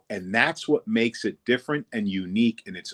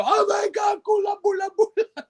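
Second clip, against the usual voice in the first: very high, squealed delight; mock audience hysterics. Audience reacting to wife's name, mock hysterics. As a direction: imitate a crowd shrieking with glee. Imitates the amused audience shrieking.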